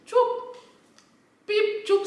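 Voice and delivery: high squeaky beeps for silence; mock beep sounds. Narrating the man going silent, with high mock beeps. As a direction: chirp the sounds like a censor bleep. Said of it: "he just".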